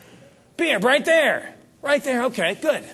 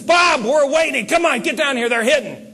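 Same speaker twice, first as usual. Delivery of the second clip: loud, high, urgent call; impatient shout; 8 semitones higher, 5 dB louder than usual. Retold or imitated. Richard Petty yelling at Bob to get down to the boat, impatient shout. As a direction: yell to hurry him down. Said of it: "He says".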